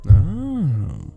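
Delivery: loud, high drawn-out "oh"; intrigued surprise. Acted. Bartender learns the guest prefers spice, intrigued surprise. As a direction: swell the "oh" in playful surprise. Reacts to guest's drink choice.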